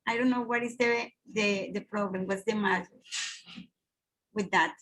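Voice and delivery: usual speaking voice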